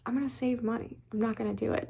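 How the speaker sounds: hushed aside quoting your own decision; quiet self-talk. Retold or imitated. Retelling resolve to herself in store.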